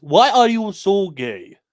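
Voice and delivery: loud, slow put-on African accent. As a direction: ham up an African accent meme voice. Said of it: "Maybe he meant to do it in the African voice".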